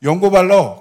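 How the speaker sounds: loud, curt doctor's verdict; 퉁명스러운 반말. Retold or imitated. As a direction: toss it out loudly in blunt casual speech. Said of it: "저한테 반말로". (큰 소리로 툭 던지는 반말)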